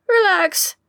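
high, squeaky and strained. A narrator's reading. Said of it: "in a high, squeaky tone".

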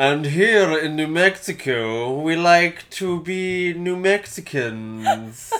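drawn-out, hammed-up New Mexico accent. Shown as a demonstration. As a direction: roll out a grand, stretched New Mexico parody. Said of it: "it sounded a lot like my Vermont".

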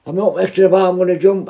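desperate prisoner refusing to come down; defiant yell. Retold or imitated. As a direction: shout back the threat. Prisoner threatening to jump.

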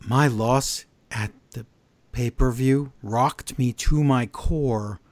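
slow, wooden Natalya imitation; mock bad acting. Recapper mocking a wrestler's backstage promo, stilted bad acting. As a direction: recite it haltingly like a poor actress. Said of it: "She doesn't know how to emphasize words".

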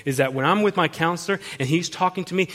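usual speaking voice

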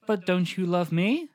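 slow, stilted bad-acting delivery; mock wooden dialogue, drawn out word by word; flat film girlfriend. Co-host playing the girlfriend to the boyfriend, stilted and flat. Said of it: "that's exactly how they talk".

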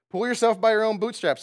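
hint of grandparents' advice; old-timer saying. Preacher imitating grandparents, lightly voiced old saying. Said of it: "the way they said it".

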